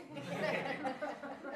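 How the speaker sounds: quiet high chuckle; soft laugh. Real, not acted. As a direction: chuckle softly at your joke. Laughs at own joke.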